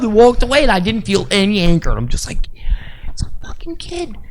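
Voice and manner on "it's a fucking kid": a high, soft shrug of a line; high, soft and unbothered; soft amused inner thought. Retold or imitated. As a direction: say it gently, letting it go. Calm inner thought about kid.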